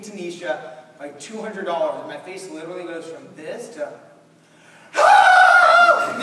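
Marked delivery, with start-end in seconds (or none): 4.9-6.2 high, loud shriek of delight; joyful scream. Real, not acted. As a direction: scream with sudden joy. Sudden joy at winning.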